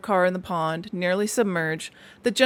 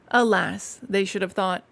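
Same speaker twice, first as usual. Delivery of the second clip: usual speaking voice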